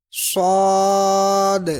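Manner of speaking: صاد very slow, heavy S, bounced final D; full, whistling, echoing. Shown as a demonstration. Teacher modelling qalqalah, full صاد with bouncing end. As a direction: whistle a thick S in صاد and echo the D. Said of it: "اس کو پر بھی پڑھنا ہے".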